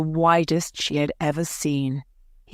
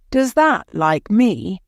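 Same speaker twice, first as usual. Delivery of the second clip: Yorkshire dialect question; 5 semitones higher, 4 dB louder than usual. Acted. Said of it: "a question in Yorkshire dialect".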